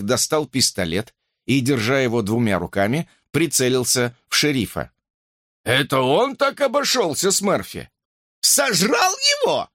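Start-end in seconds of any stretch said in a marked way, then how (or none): 5.6-9.7 rising to an alarming falsetto; climbing into falsetto. A narrator's reading. Policeman learning of his colleague's death, voice climbing to falsetto. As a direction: start high and soar far higher. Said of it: "Голос его взлетел на пугающий фальцет".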